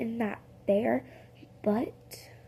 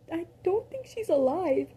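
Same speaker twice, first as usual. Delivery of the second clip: raised, hesitant concern; high worried voice; 7 semitones higher than usual. Retold or imitated. Billy worried about the beaten girl, high and hesitant. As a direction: sound uneasy.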